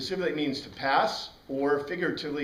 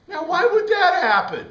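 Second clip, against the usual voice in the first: high, loud mock astonishment; mock-baffled question to the class; mock puzzlement; 14 semitones higher, 10 dB louder than usual.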